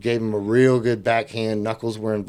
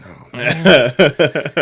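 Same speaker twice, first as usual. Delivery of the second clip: louder, mock-deflated groan; disappointed; 3 semitones higher, 7 dB louder than usual. Cop denied his chance to fight, disappointed. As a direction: play up the letdown. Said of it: "he was like, all disappointed".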